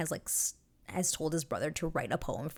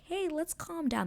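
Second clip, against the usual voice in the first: much higher, timid "hey guys"; mock pleading interruption; 12 semitones higher than usual. Outsiders trying to interrupt the bickering, much higher. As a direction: chirp it up high to break them up. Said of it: "who's trying to be like".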